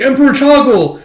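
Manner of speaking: high, loud protest; pleading outcry. Acted. Captive to the emperor after a kill order, pleading shout. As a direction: cry out to stop the emperor.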